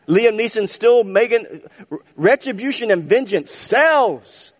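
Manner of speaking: high, loud pulpit delivery; full-force preaching. Acted. Pastor rousing the church about payback movies, loud preaching. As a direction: preach it out big to the congregation.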